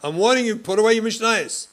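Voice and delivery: very loud, high threatening shout; furious warning. Retold or imitated. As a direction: escalate into a full-on yell of warning. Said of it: "You walk over".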